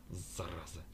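low, hushed curse; very quiet. A narrator's reading. Captain after downing the vodka, very quiet. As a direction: say it under your breath. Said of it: "а после добавил совсем тихо".

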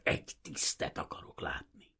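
tight, voiceless seething demand; fury held in, whispery; through gritted teeth. A narrator's reading. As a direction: force it out through clenched teeth. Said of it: "csikorgatta a fogát".

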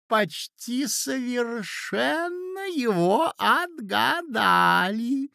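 high, slow character voice; doddering old prince. A narrator's reading. Prince answers the ladies about his dream, high and slow. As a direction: draw it out high like a befuddled prince.

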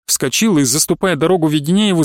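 ordinary delivery, nothing marked

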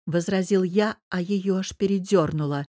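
plain reading voice

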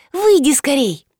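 high, urgent little voice; young girl urging someone out; шепчущий голосок. A narrator's reading. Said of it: "зашептал голосок". (тонким голоском, торопя)

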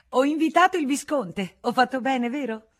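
high, loud, eager for approval; gleeful announcement. A narrator's reading. Marescialla back with her guest, gleefully seeking approval. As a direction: announce it brightly and fish for agreement.

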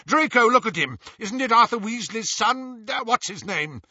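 high, loud, urgent questioning; shouted. A narrator's reading. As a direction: press him excitedly, pitched high. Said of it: "shouted Lucius".

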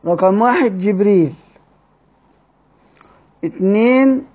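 normal talking voice

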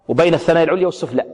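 normal talking voice

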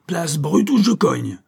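loud, slow, high shout. Acted. Keely shouting at the agents as he charges the door. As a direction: bellow the threat at the guards. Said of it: "cria-t-il".

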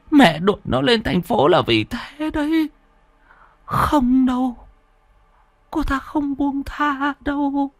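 a scared grandma warning; frightened grandmother. Acted. Bà nội hoảng sợ nói. (giọng bà cụ hoảng sợ, cao và nhỏ)

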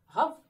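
loud high woof; dog bark. Acted. Narrator barking as the dog, loud. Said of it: "озвався Бровко".

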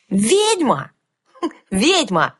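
loud joyful cry; excited shout. A narrator's reading. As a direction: burst out in sudden glee. Sudden excited realization.

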